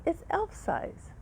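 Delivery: very high, slow, breathy mock gripe; mock complaint. Patient mocking the hospital's tiny waters in a high voice. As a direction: squeak out the tiny size. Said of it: "And I would say".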